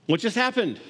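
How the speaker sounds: ordinary talk, nothing marked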